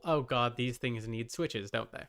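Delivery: ordinary talk, nothing marked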